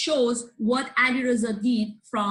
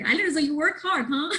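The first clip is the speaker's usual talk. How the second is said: teasing; 7 semitones higher, faster than usual. Real, not acted. Teasing the student.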